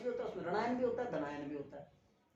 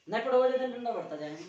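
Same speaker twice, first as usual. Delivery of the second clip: louder, threatening the class with the stick; stern warning; 3 semitones higher, 9 dB louder than usual. Real, not acted. Teacher warns the class, loud and stern. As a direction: bark the warning at lazy students.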